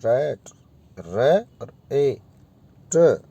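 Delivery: rat split with pauses between its sounds; broken into syllables. Shown as a demonstration. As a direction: say रैट as रे ... ए ... ट, pausing between each part. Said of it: "बीच में ठहराव आएगा".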